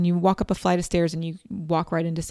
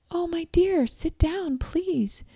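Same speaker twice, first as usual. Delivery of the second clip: much higher, slow, sugary greeting; sweet healer voice; 11 semitones higher, slower than usual. Retold or imitated. Acupuncturist welcoming the sweaty newcomer, sweetly high. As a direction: greet her like a gentle kind host. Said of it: "She's very sweet".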